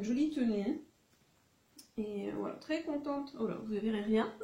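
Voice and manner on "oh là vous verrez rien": normal talking voice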